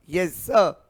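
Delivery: normal talking voice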